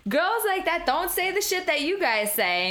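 hammed-up disbelief; stunned guys. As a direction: play the shocked dinner guests. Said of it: "their mouths just gaped open".

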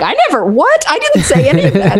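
high, startled retold protest; mock disbelief. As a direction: act out a baffled denial. Friends' shocked reaction acted out.

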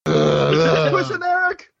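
high, slow, whiny squeak; silly high voice. Acted. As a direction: stretch it out in a tiny whine. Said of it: "Do that again".

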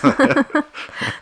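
bright giggle; high laugh. Real, not acted. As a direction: burst of laughter pitched high. Laughing after handing over.

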